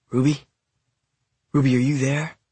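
lightly voiced anxious call with rising questions; a touch of urgency, calling for someone unseen; hushed, desperate call. Brother calls for missing Ruby at the window, mildly desperate. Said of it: "a deep voice came, hushed and desperate".

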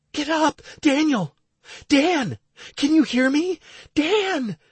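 high, urgent woman's voice; anxious. A narrator's reading. Wife trying to rouse her unconscious husband, anxious. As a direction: call out in anxious, high-pitched alarm. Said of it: "Stacy's anxious voice tore through".

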